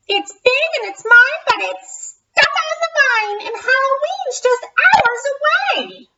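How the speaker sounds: high, quick cackly witch talk. Acted. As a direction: rattle it off like a witch. Witch voice again.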